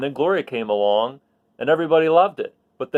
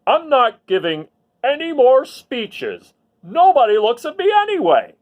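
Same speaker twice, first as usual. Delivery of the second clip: frowning officer; 9 semitones higher, 6 dB louder than usual. Acted. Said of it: "Officer Buckle frowned".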